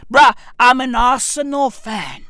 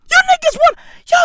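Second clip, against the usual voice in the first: ordinary delivery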